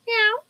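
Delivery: high mock cat meows; meowing. Meowing at Maggie the cat to coax her over. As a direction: squeak little meows like a kitten.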